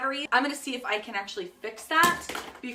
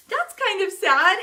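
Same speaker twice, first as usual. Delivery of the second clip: high, louder pouty lament; pouty regret; 9 semitones higher, 8 dB louder than usual. Real, not acted. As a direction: say it in a higher, pitying little voice.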